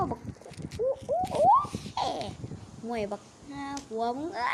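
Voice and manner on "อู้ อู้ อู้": high hoots. Acted. Character howls at the bad fuel.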